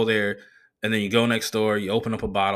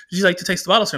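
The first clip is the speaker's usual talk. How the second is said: higher, proper waitress voice rising on "sir"; imitating the waitress; 8 semitones higher, 4 dB louder, much faster than usual. Comic voicing the waitress offering a taste politely. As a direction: offer it politely like fine dining. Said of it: "she was like".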